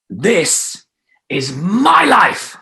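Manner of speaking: slow, high, loud "This is my life"; stronger declaration. Shown as a demonstration. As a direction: declare it with full power. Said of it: "it's gonna be stronger".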